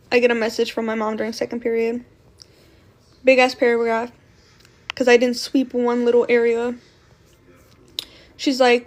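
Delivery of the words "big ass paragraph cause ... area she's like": ordinary talk, nothing marked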